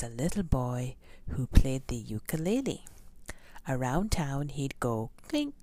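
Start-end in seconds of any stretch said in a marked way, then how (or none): none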